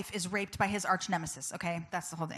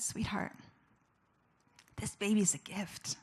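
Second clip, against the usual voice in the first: hushed, slow, choked-up retelling; understated tearful tenderness, lightly imitated; tearful husband. Husband starting to cry at the news, tearful. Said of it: "this is him bawling".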